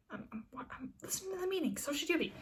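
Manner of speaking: soft hushed whisper, not voiced; whispered reply. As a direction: hush it like you are in a meeting. [name] shushing in the meeting.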